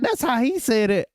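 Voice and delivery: high-pitched mock protest; defensive protest. Husband defending his pronunciation to his wife, mock indignation. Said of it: "I'm like".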